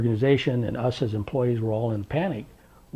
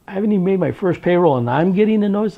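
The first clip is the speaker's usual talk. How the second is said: higher, louder, rushed protest; alarmed disbelief; 7 semitones higher, 6 dB louder, faster than usual. Retold or imitated. Newly made director reacting to a sheriff's notice, alarmed. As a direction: blurt it out in disbelief, pitch jumping up.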